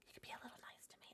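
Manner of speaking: soft breathy whisper. Real, not acted. Streamer whispering to chat that she feels pretty. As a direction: murmur it just for chat.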